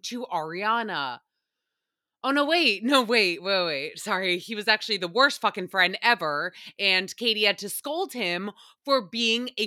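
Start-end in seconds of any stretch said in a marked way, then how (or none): none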